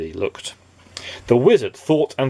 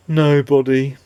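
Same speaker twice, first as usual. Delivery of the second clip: mournful character voice; mournful, melancholy; 3 semitones higher, 4 dB louder than usual. Acted. A nobody answering Mister Happy, mournful voice. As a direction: let it droop with sorrow. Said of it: "in a mournful, melancholy voice replied".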